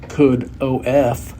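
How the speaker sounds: "could O F" spelled slowly, letter by letter; spelling out slowly. Shown as a demonstration. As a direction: spell out O F with deliberate slowness. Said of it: "if you spell it as could of".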